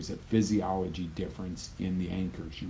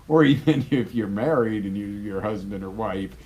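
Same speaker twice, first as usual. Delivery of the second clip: normal talking voice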